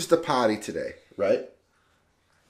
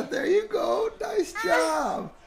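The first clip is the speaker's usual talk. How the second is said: excited cheer; 3 dB quieter, much slower than usual. Real, not acted. Cheering baby holding bottle.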